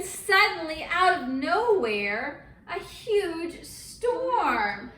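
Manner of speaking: a mom acting out the storm story for children, building drama; dramatic storytelling. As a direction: paint the storm for the kids.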